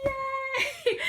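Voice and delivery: high, loud squeal of "Yay"; excited cheer. Retold or imitated. Fan reliving Ketch's defeat, cheering with a high squeal. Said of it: "And I was like".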